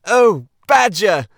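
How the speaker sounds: loud, high, drawn-out call; cried out. Acted. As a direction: call out with relief. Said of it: "cried the Rat".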